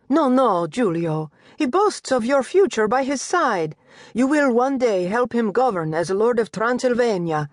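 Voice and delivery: high, lilting mother announcing news; Italian mother's voice. Acted. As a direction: voice the doting Italian mistress. Mother reassures son.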